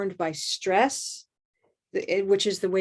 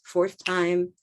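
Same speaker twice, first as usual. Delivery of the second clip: ordinary delivery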